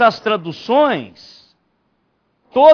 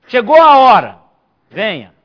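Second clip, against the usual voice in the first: urgent divine call; 9 dB louder than usual. Acted. God's call to Noah.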